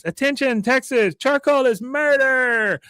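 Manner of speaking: high shouted loudspeaker announcement; mock girl's shrieked protest broadcast; Luann. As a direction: proclaim it high, stretching the last words. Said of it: "Luann's voice come over a loudspeaker".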